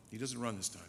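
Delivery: ordinary delivery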